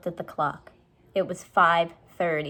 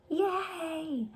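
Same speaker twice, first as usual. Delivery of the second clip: high, soft excited whisper; whispered cheer; 10 semitones higher, 6 dB quieter than usual. Acted. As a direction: cheer without making noise. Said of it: "whispered Annie".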